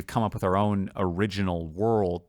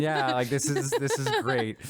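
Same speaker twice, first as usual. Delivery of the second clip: laid-back dark fairy; 6 semitones higher than usual. Retold or imitated. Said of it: "and they're like".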